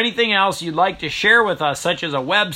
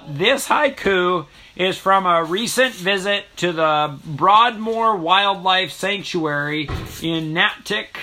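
usual speaking voice

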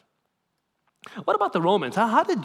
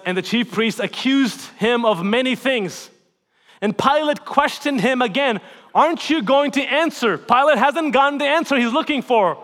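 raised, impassioned sermon delivery; preaching at full force; 8 semitones higher, 9 dB louder than usual. Acted. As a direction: preach it out to the congregation.

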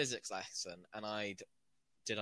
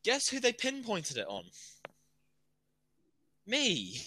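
indignant; 7 semitones higher, 10 dB louder than usual. Real, not acted. Outraged at being blamed.

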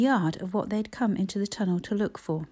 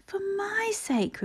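high, slow, breathy plea; pleading; 12 semitones higher, 3 dB quieter, slower than usual. A narrator's reading. Older girl repeating the plea to the fainted boy, pleading. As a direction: implore softly, drawn out high.

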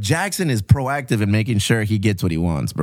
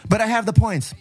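high mock kid protest; 9 semitones higher than usual. Dad imitating his son claiming his points, high and insistent. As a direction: pitch up, insisting like a child.

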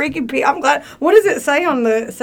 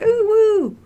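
put-on high drunken whoop; 12 semitones higher than usual. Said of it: "hanging out the window".

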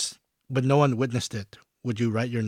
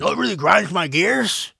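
lightly voiced, higher, louder catchphrase; catchphrase impression; 6 semitones higher, 7 dB louder than usual. Quoting a friend's segment line in a mild imitation. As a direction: toss out the famous rant opener. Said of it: "That's Will's segment".

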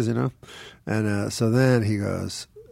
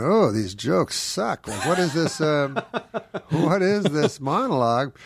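higher, slow, scornful complaint; 6 semitones higher than usual. Retold or imitated. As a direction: sneer at the jokes. Mocking bad jokes while watching.

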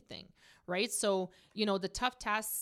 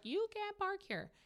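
quoted angry man, pitched way up; retold angry yell; 12 semitones higher, 4 dB quieter than usual. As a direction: imitate the neighbor shrieking. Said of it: "screaming at me at the top of his lungs".

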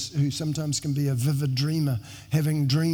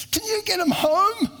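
much higher, squeaky comic angel voice; pleading angel; 4 dB louder than usual. Retold or imitated. Pastor imagines angels pleading to get him home, squeaky comic voice. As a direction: play a frantic little angel begging for help. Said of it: "I imagine some kind of angels there".

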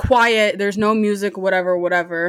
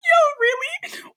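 high, laughing "Yo, really?" in shock; laughing disbelief; slower than usual. Retold or imitated. Retelling the date's tiny order to listeners, laughing in disbelief. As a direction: crack up while asking yo really.

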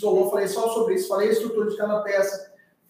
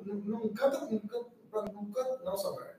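playful quiet scolding; 12 dB quieter than usual. Real, not acted. Jokingly telling viewer to stop.

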